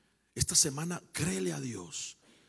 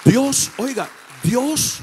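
loud, raised exclamation to the church; forceful preaching; 7 semitones higher, 11 dB louder, slower than usual. Real, not acted. Preacher telling church how God moves, forceful preaching. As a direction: call out God's name with force.

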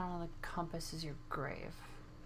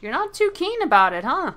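teasing; 9 semitones higher, 9 dB louder than usual. Real, not acted. Gamer teasing a creature.

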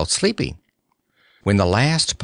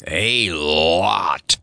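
low lion voice; lion licking his chops; hungry lion voice; 5 semitones lower than usual. A narrator's reading. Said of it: "added the Cowardly Lion".

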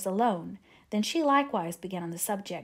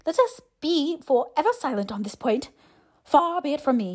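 higher-pitched, stiffly formal reply; displeased; 6 semitones higher, 5 dB louder than usual. A narrator's reading. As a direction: show mild displeasure with formal politeness. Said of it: "in a voice that marked his displeasure".

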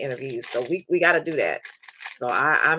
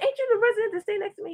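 surprised recognition; faster than usual. Retold or imitated. Recognizing him on campus.

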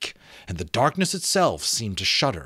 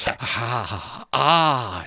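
satisfied sigh; 3 semitones higher than usual. Acted. Skull savouring wine.

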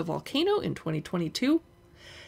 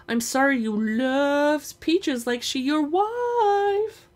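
slow, higher sing-song teasing; mock apology; 7 semitones higher, much slower than usual. Brendy mock-apologizing to tease her brother, slow sing-song. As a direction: drag it out to rub it in. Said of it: "Brendy said".

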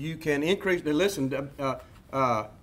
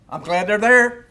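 louder, fired-up protest; loud rant; 4 semitones higher, 9 dB louder than usual. Real, not acted. Lecturer ranting to the class, loud and fired up. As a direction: raise the voice and push the point. Rant about birth control.

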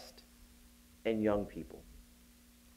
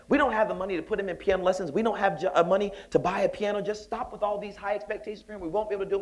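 exasperated mom; 8 semitones higher, faster than usual. Retold or imitated. Mom objecting to piano lessons.

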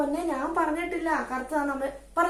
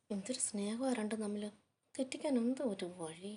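much softer, muttered to herself; sour aside under the breath; 2 semitones lower, 11 dB quieter than usual. Acted. Mother grumbling about the sisters' closeness, soft sour aside.

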